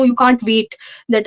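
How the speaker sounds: normal talking voice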